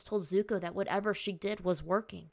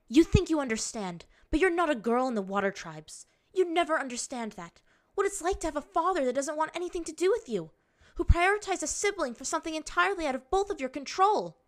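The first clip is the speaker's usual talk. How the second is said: heated, high and louder, building; bitter outburst; 9 semitones higher, 5 dB louder than usual. A narrator's reading. Waterbender rebuking him about her father, bitter outburst. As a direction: lash out with mounting hurt anger.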